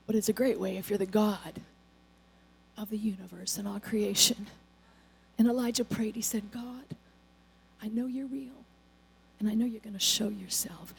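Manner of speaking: hushed prayer. Acted. Preacher retelling Elijah's prayer to God, hushed. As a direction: drop to a near hush for the prayer. Quiet prayer moment in sermon.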